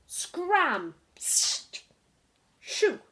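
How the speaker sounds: loud high shooing calls; shouting. Acted. The townspeople drive Mog out, loud shouting. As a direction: chase the cat away with shouts. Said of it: "everybody shouted".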